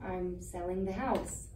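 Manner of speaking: subdued, careful bad news; gentle repeat. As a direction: break it gently and slowly. Repeats news after shocked What.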